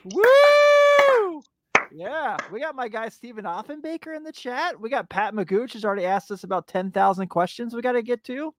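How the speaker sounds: ordinary talk, nothing marked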